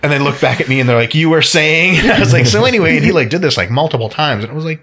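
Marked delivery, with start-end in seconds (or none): 1.1-2.1 sarcastic. Retold or imitated. Women's pointed retort, retold. 2.5-3.0 dismissive. Retold or imitated. Dismissive retort, retold.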